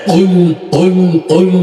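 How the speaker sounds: কুল with the q pushed deep in the throat; deep guttural qaf in কুল. Shown as a demonstration. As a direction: drive the ক of কুল from the back of the throat. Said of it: "গলার ভিতর দিকে ধাক্কা দিতে হবে".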